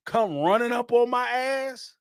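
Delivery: usual speaking voice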